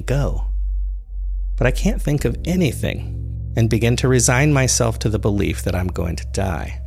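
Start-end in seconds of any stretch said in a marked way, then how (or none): none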